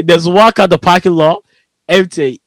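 usual speaking voice

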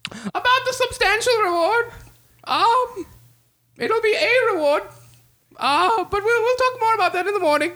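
high, nervous royal voice, hurrying; a king stalling over the reward he can't pay; flustered king. Acted.

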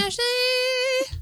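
high, excited name call; excited announcer. Pretend. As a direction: hype up the name.

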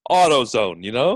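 usual speaking voice

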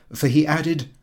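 ordinary delivery

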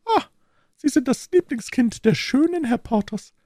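very high, wistful falsetto lady; sighing. A narrator's reading. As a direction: sigh it out as a hurt wife. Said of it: "entgegnete seufzend die Prokuratorsfrau". (seufzend, in hoher Frauenstimme)

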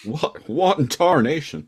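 jokey yokel outrage; mock cowboy. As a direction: echo the old prospector outrage.

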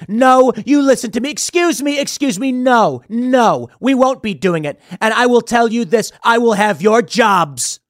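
forceful Trump. Retold or imitated. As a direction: slam the table and take charge. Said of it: "who looks at them and says".

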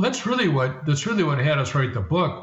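ordinary delivery

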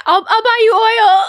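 high, loud, drawn-out wail; slow, tearful-sounding mock plea; hammed-up pleading. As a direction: wail the offer in mock desperation. Acting out frantic consoling offer.

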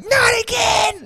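stretched-out high-pitched cry of dismay; panicked wail. Retold or imitated. Caught man panics again.

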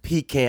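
Pecan with stressed PEE, then can. Shown as a demonstration. Host echoing co-host's old habit, Pecan as PEE-can. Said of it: "a lot of people call it".

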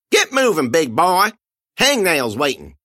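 put-on high, sneering old man voice, pushed loud; Otis, high mocking. Old man shoving his prisoner, high sneering jeer.

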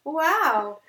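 fake delighted. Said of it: "them being like".

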